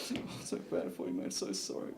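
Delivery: whispered, soft teary apology; hammed-up crying stranger; mock crying. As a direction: sob it out in a whisper. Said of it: "starts crying".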